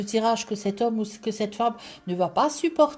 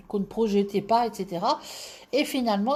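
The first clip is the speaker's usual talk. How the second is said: ordinary talk, nothing marked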